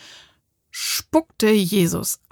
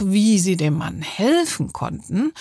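usual speaking voice

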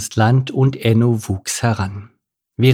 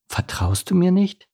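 slightly sad, higher-pitched question; a bit sad; 8 semitones higher than usual. A narrator's reading. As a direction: ask with a touch of sadness. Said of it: "ein bisschen traurig".

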